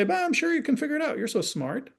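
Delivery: high and fast chirpy reassurance; brightly brushing off the kid's problem; mock cheerful parent. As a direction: breeze through it like an upbeat parent.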